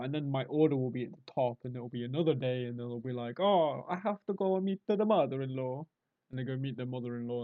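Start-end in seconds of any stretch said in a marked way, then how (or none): 3.4-5.9 high-pitched mock Italian worker; Italian accent. Italian worker excusing himself to visit the mother-in-law, mock Italian accent. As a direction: put on a comic Italian lilt. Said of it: "I say Italian".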